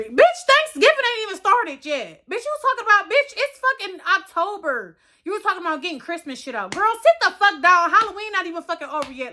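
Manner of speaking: shrill furious telling-off; angry rant. Retold or imitated. Ranting at someone about Christmas decor.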